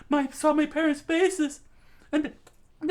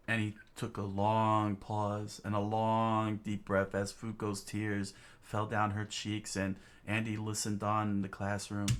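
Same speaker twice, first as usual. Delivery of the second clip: much lower, soft, slow storyteller voice; story narration; 10 dB quieter than usual. A narrator's reading. Narrator describing the tearful classroom, low hushed storytelling.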